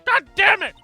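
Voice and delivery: loud furious yell; angry shout. Acted. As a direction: explode at the defense. Prosecutor explodes again.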